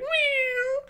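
very high, thin mewing; squeaky put-on cat call; pretend cat. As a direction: meow like a cat in a tiny high voice. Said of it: "go around pretending to be cats".

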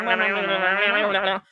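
low, odd meow noises; meowing like grinding teeth; mock garburator meow. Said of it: "sharp teeth that goes meow".

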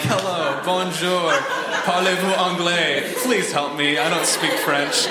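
mock plea for help through a language barrier; helpless tourist. Tourist appealing to French strangers, playful mock helplessness. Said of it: "it's so funny trying to talk to French people and, like, being like".

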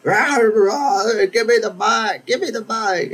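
high, far shrill-free mock of a rowdy lady demanding the mic; yelling lady. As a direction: play a pushy woman grabbing for the mic. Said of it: "she's like".

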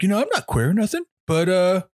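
high, fast, rambling oddball voice; mock dopey weirdo. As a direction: rattle it off like an awkward creep. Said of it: "he'll go up to Trey Parker and be like".

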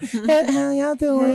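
job interview voice. Retold or imitated. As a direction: put on a fake polite interview voice. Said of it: "their job interview voice".

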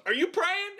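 high-pitched incredulous question, imitated; Nick Fury. Commander catches someone praying as the ship goes down, mock incredulous. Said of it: "Nick Fury goes".

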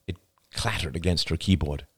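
ordinary delivery, nothing marked

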